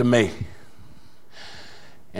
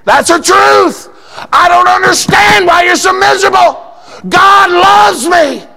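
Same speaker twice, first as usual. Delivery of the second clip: preaching shout; 6 dB louder than usual. Real, not acted. Preacher shouting God's love to his church. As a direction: proclaim it at full force.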